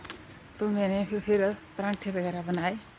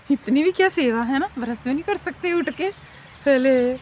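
higher, louder exasperated scolding; 6 semitones higher, 5 dB louder than usual. Real, not acted. Mother complaining about lazy children, exasperated and loud.

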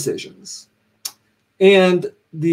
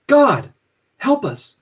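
higher-pitched plea, first word punched loud; crying out; 10 semitones higher, 3 dB louder, slower than usual. A narrator's reading. The faithful crying out to God in the storm, high plea. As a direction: cry out to heaven for help. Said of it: "The faithful once more cried out".

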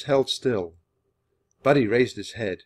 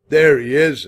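loud, gruff groom calling out; rough voice, high and loud; 5 semitones higher, 12 dB louder than usual. A narrator's reading. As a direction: bellow it gruffly. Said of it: "a gruff voice said".

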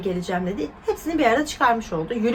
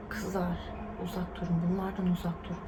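hushed warning; 3 semitones lower, 10 dB quieter than usual. Real, not acted. Warning girl viewers away from such men, hushed and soft. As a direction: lean in close and warn them quietly.

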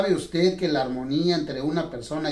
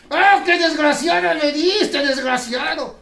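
mock outrage; 10 semitones higher, 7 dB louder than usual. Ventriloquist scolds dummy Dani.

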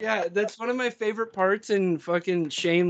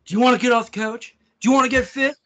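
higher, louder fitness-ad challenge; mock ad pitch; 6 semitones higher, 5 dB louder than usual. Personal trainer in an ad pitching to couch-sitters, mock salesy. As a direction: send up a pushy trainer's advert. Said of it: "Yeah, it's ads".

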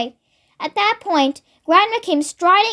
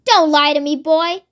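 usual speaking voice

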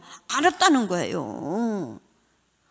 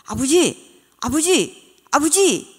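calling out; 5 semitones higher, 6 dB louder, slower than usual. Retold or imitated. Child calling for missing father.

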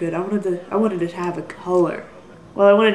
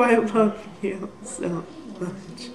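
tender declaration; 6 dB quieter, much slower than usual. Real, not acted. Declaring love to subscriber Gina.

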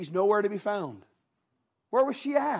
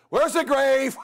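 sudden higher shouted demand; mock shout; 4 semitones higher, 4 dB louder, slower than usual. Preacher voicing Jesus asking for the grave, comic shout. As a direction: shout it out, playfully exasperated.

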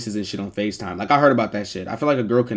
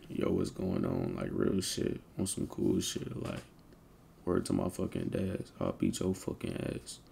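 very soft, low, slow murmur; put-on deep voice; 5 semitones lower, 14 dB quieter, slower than usual. Shown as a demonstration. Streamer asking chat to judge his voice, hushed and deep. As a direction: drop right down deep and quiet. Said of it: "let me lock in real quick".

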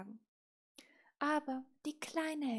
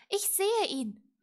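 loud, high character voice; little witch; 9 semitones higher, 10 dB louder than usual. A narrator's reading. Little witch answering her raven, lively and high. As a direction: answer higher and louder.

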